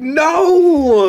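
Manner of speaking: high loud exaggerated no; mock refusal. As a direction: cut him off. Retold flat refusal.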